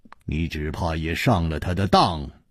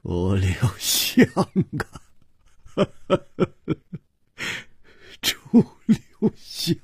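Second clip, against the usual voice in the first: high, slow laugh with grief and rage in it; bitter laughter; 8 semitones higher than usual. Acted. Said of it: "大笑着说". (李玉涵悲愤地大笑着喊)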